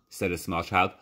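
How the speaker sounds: ordinary delivery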